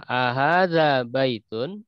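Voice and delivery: "Ahadza baitun?" read slowly with a rising question tune. Shown as a demonstration. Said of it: "nadanya nada pertanyaan ya".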